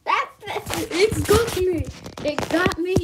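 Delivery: loud, high panicked cry; panicked yell. Pretend. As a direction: yell as if caught. Monster attacks.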